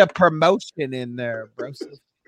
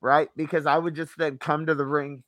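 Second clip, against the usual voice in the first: ordinary talk, nothing marked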